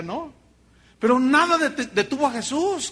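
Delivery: normal talking voice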